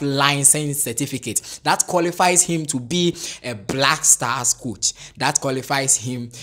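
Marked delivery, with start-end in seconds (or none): none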